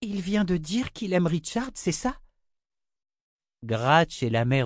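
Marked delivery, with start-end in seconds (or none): 0.0-2.2 put-on high, disbelieving child's question; a child who can't believe her ears; astonished little girl. A narrator's reading. Rachel asking her mother if the monster spoke, high astonishment.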